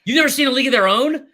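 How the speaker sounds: high, loud disbelief; can't believe what he just heard; real shock, voice jumping up; incredulous. Real, not acted. Disbelief at cohost.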